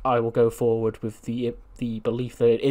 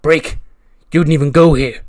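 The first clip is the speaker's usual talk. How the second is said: loud, higher, incredulous outburst; Karrion Kross challenging; 3 semitones higher, 12 dB louder than usual. Acted. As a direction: throw it back at him loudly. Kross confronts the stranger.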